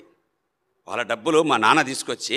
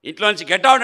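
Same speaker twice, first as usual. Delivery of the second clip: sharp, raised mock shout at get out, much higher and louder; retold get out shout; 6 semitones higher, 5 dB louder, faster than usual. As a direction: bark get out like an angry father ordering him away. Father throwing him out, retold. (కోపంగా get out అని అరుపు)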